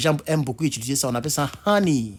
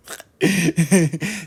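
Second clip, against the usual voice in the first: petit rire; 4 semitones higher, 4 dB louder than usual. Real, not acted. Rit de son erreur.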